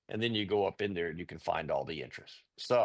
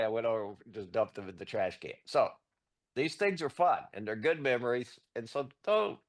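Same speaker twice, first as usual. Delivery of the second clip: ordinary delivery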